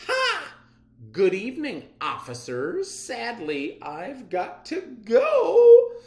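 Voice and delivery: high mocking villain voice with a laugh, drawn out on go; gloating villain. Miss Matter taunting officers as she escapes, high and laughing. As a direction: taunt the cops as you slip away.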